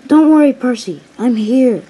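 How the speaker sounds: loud, high reassurance; acted hearty reassurance; rescuer's call. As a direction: call out that help has arrived. Duck arrives to rescue Percy.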